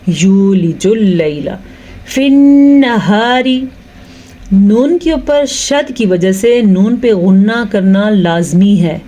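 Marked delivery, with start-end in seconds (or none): 0.1-3.5 یولج اللیل فی النہار drawn out slowly, a long یو and ghunna on the نّ; slow correct recitation. Shown as a demonstration. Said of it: "یا پیش واو یو".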